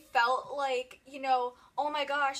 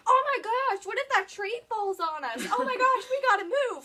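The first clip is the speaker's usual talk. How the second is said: panicked; 7 semitones higher, 7 dB louder than usual. Retold or imitated. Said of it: "and it's like".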